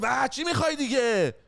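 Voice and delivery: put-on father snapping at his child in the boat; mock angry snap. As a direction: snap at the kid like an annoyed dad. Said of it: "بابا برگشت گفت".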